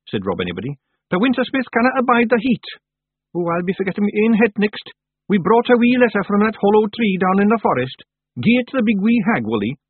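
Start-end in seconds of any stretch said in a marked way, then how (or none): 1.1-9.8 much higher, louder Scots pixie, brisk; a tiny Scots chief bustling along; Scottish Feegle chief. Acted. Feegle Rob gives news.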